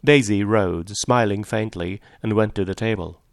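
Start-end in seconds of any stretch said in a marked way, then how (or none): none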